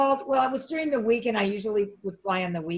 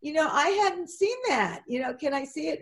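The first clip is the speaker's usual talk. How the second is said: high, bright mock amazement; put-on delight; 7 semitones higher than usual. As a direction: fake surprise to start a chat. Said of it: "I said".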